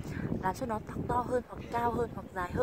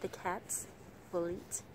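very slow; 5 dB quieter, much slower than usual. Shown as a demonstration. Teacher slowing down for beginners, careful and measured. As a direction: break after cats, then slowly "will eat the fish". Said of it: "Mình đọc rất là chậm này".

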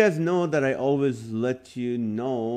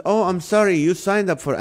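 higher-pitched imitation Indian accent; lightly mimicked Indian accent, pitched up; 7 semitones higher than usual. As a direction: imitate a call-center agent with an Indian accent. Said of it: "he had an Indian accent".

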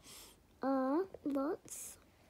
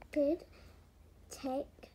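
usual speaking voice

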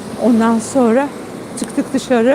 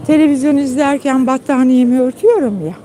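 ordinary talk, nothing marked

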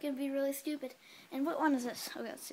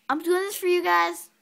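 loud complaint; 5 semitones higher, 12 dB louder than usual. Real, not acted. Eating earwax bean.

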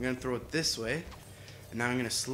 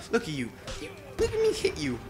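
gloating; 8 semitones higher than usual. Acted. Gloating while hitting an enemy.

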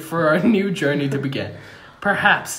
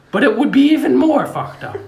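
high, loud emphasis; emphatic outburst; 9 semitones higher, 8 dB louder than usual. Acted. King stressing his point to the crowd, loud. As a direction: lean hard into it.

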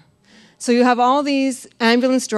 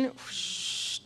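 breathy whoosh; 4 semitones higher, 15 dB quieter than usual. Retold or imitated. Spirit rushing in.

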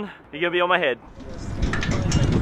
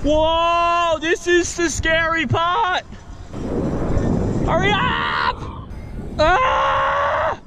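scared yelling; 6 semitones higher, much slower than usual. Real, not acted. Swinging on the bungee.

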